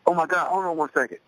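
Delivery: high, flustered panic on the phone; mock-panicked friend rushing to hand off the phone. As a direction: blurt it out in a startled rush. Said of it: "automatically panicked".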